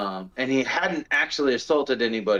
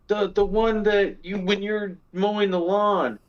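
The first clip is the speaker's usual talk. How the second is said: higher, lightly imitating a frightened wife; a hint of a scared wife on the phone; wife's scared voice; 7 semitones higher than usual. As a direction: mimic her nervous explanation, pitched up. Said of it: "And she's like".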